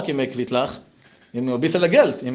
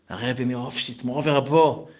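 ordinary delivery

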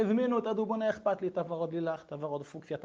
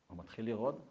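ordinary talk

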